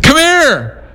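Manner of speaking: high shouted call. Retold or imitated. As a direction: wave them over with your voice. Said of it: "and I yelled at them".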